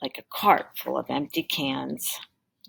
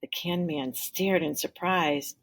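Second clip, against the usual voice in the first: ordinary delivery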